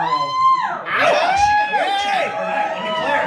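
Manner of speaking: very high, loud whoop; hammed-up cheer. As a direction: cheer like a rowdy fan. Crowd cheers, mock cheering.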